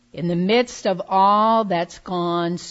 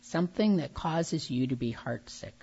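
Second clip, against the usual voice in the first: calm grounding exercise. Real, not acted. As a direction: lead them gently into stillness. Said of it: "let go of distractions".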